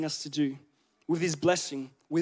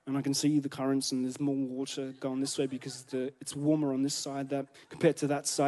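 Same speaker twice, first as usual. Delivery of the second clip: lower, softer expert fisherman murmur; lightly voiced fishing guru reading the water; a hint of a hushed fishing captain explaining currents; [name] voice; 7 semitones lower, 8 dB quieter than usual. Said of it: "His voice changes".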